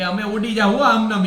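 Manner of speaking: little papads loudly teasing the grandmother; playful papad. Acted. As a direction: tease like crisp papads about to fly off. Papads threaten to fly away.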